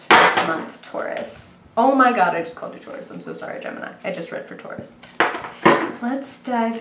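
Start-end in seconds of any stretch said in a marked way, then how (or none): 1.8-2.4 startled oh my god. Real, not acted. Catching her own slip. 2.4-5.0 embarrassed whisper, almost no voice; whispered apology. Real, not acted. Tarot reader apologising to Gemini viewers for a slip, whispered.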